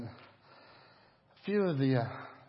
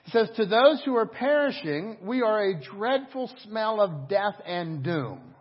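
high, loud, slow preaching build; 8 semitones higher, 10 dB louder than usual. Acted. Pastor reading scripture to the church, loud and slow. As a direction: lift the verse to the church.

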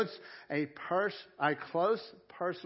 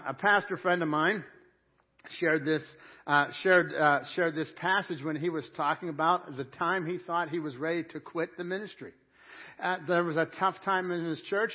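ordinary delivery

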